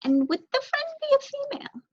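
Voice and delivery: high, sly question; knowing mouse voice. Acted. Mouse guessing the crow's friend, high and sly. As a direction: tease him gently with a high voice.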